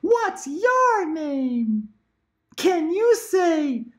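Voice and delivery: very high squeaky toy doll voice; annoying talking doll. Acted. Mom imitating an annoying talking doll, very high. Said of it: "annoying Dora doll".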